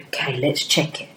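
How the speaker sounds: soft whispered okay, let's check. Shown as a demonstration. Teacher whispering to pupils before checking. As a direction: breathe it quietly.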